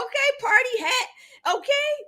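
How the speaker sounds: high, ringing shout of delight; excited shout. Real, not acted. Host greets a viewer's party hat with a delighted shout. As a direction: cheer it out like a party starter.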